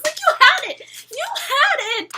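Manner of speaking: loud, high-pitched frustrated complaint; exasperated outcry. Retold or imitated. Fan to the show about the greenhouse, exasperated outcry. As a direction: cry it out at a missed chance. Venting at the show's writers.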